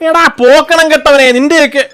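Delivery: slow, high, loud insult; angry outburst. Acted. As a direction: stretch out the insult in exasperated anger. Insulting driver after wrong drop. (ദേഷ്യത്തോടെ നീട്ടി ഉറക്കെ ശകാരം)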